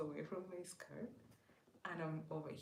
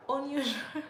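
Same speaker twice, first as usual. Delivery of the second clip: sister warning; 9 semitones higher, 14 dB louder than usual. Retold or imitated. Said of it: "And then she goes".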